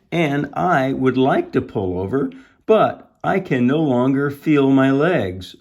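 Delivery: exasperated Mister Watson. A narrator's reading. Driver explaining he cannot stop, emphatic acted protest. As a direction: stress each word as a helpless complaint.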